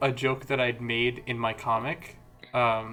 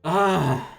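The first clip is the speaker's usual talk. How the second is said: higher, louder exasperated "ah"; frustrated groan; 5 semitones higher, 6 dB louder than usual. Real, not acted. Having opened the case by mistake, frustrated groan.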